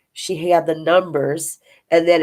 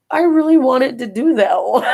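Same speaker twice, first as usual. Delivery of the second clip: whiny mom; 8 semitones higher, 6 dB louder than usual. Retold or imitated. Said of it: "my mom goes".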